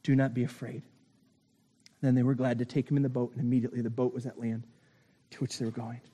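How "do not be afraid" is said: tender reassurance. Acted. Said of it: "Jesus says to them".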